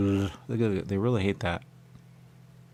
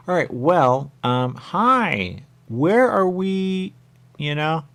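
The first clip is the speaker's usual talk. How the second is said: high, slow, louder put-on voice; high silly voice; 8 semitones higher, 8 dB louder, much slower than usual. Player asks the game where they are, high slow voice. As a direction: stretch it out high and sing-song. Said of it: "you start to speak in this way".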